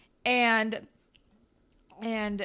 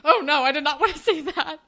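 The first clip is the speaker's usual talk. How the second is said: loud, higher imitated horror with a laugh; mock dismay; 5 semitones higher, 8 dB louder than usual. Teacher sees the photo by accident, loud mock dismay. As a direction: recoil in comic regret, half laughing.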